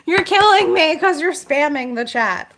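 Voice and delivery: high, loud, exasperated outburst; exasperated yell. Real, not acted. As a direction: yell at the chat spammer in exasperation. Exasperated second take to spamming viewer.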